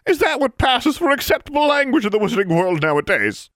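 put-on a haughty old lady appalled; prim scandalised crone. Goody Pruitt scolding the wizard, indignant.

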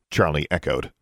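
plain reading voice

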